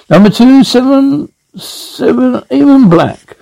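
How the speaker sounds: lightly acted croupier, pitched high; a hint of a croupier's high sing-out; casino croupier call. Casino dealer calling the results.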